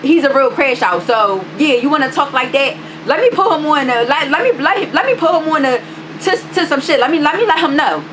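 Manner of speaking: high-pitched, challenging warning; worked-up retold clapback, pitched high; retold threat. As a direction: threaten to bring in backup, retelling it.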